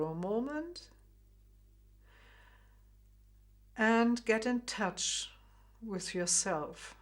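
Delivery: calm guided meditation with long pauses; gentle meditation guide. Acted. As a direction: ease the listener inward. Leads a short inner check-in.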